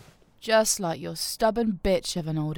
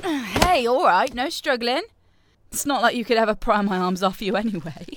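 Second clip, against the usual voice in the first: gloating taunts; 5 semitones higher, 5 dB louder than usual. Acted. Louder mocking taunts.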